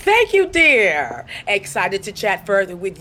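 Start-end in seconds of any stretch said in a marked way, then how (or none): 0.0-1.2 high, loud put-on sweetness; sugary phone voice. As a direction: lay the charm on thick for the caller.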